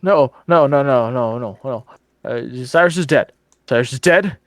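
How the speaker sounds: loud, rising panicked denial; distraught denial. Acted. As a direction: repeat it, coming apart. NPC denies brother's survival.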